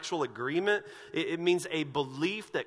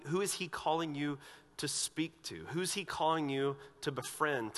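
ordinary talk, nothing marked

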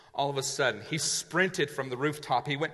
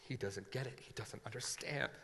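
much softer, low muttered thought; inner thought; 5 semitones lower, 16 dB quieter than usual. Acted. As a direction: say it under your breath. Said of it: "he thought".

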